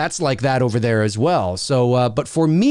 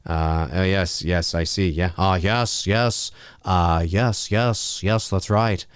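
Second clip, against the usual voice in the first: low, hesitant murmurs of agreement; low-voiced, halting actor impression; Jeff Goldblum; 7 semitones lower, slower than usual. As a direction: stammer out drawn-in "uh"s and musing yeses, pitched low. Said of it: "Jeff Goldblum, folks. Jeff Goldblum".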